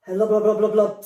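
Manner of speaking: loud, gurgly bubbling words; bubbling underwater. A narrator's reading. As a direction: blub it out like sinking into water. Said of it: "said Mister Bear".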